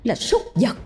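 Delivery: wife's bitter reproach. Acted. Wife confronting drunk husband.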